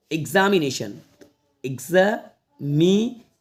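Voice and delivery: Examination slowly split "eg-za - mi - ne - shan"; broken into syllables. Shown as a demonstration. Teacher showing how to read big words, slow separate syllables. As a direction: break examination up with long gaps. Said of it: "ऐसे तोड़ के पढ़ लेना है".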